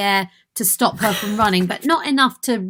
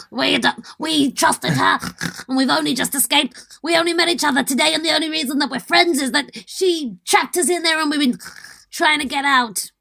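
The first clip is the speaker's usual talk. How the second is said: put-on higher-pitched Orcish accent; 7 semitones higher than usual. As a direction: argue your case in a put-on orc accent. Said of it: "orc accent".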